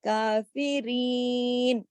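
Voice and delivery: کافرین with the یا stretched long, slowly; drawn-out Qur'anic reading. Shown as a demonstration. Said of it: "اس کی آواز ایسی ہوگی".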